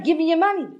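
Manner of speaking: retold gunman repeating himself; robber's repeated demand. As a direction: keep pressing like the robber. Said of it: "So I kept saying".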